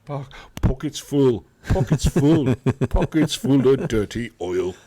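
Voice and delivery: sings it in a hammed-up Scottish lilt, half laughing; laughing through a cheesy Scottish accent; mock Scottish accent. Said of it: "It didn't sound Scottish".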